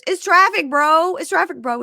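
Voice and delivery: high, loud protest on the phone; mock defensive outburst; defensive retort. Retold testy phone argument.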